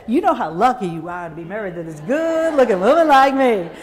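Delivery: loud Southern brag through a laugh; teasing Southern brag. Retold or imitated. Woman bragging to her husband, laughing in a loud Southern accent. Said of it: "found my husband and said".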